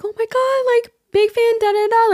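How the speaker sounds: high-pitched mock fangirling; imitated fan excitement. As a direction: imitate starstruck fans in a high voice. Acts excited friends awaiting Lana.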